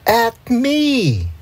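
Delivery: high, outraged squeak; can't believe he means me; indignant. A narrator's reading. Narrator indignant at being singled out.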